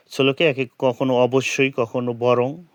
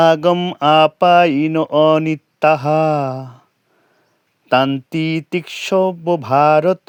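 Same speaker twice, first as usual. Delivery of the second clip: slow, louder, measured verse chant; correct recitation; 5 dB louder, slower than usual. Shown as a demonstration. Teacher models the correct verse for students, slow and loud. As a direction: intone the whole verse with care, every syllable clear. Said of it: "শুদ্ধ উচ্চারণটা আমরা এইভাবে পড়ব".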